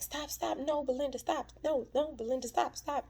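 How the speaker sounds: imitating a man screaming to stop, hammed-up; high, pleading mock cries of no. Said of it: "screaming and yelling".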